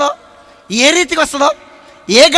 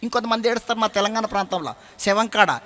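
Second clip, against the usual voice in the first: usual speaking voice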